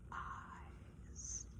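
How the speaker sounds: a whispered word. Acted. Narrator lingering on the witch's gaze, whispered. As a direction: breathe the word out.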